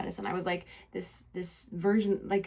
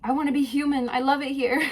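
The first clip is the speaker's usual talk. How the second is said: joyful plea; 6 semitones higher, 5 dB louder than usual. Retold or imitated. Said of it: "And I'm saying".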